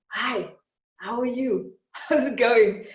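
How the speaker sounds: a breezy, happy hello with a laugh; cheerful greeting. Retold or imitated. Mandela greeting the angry crowd, cheerful.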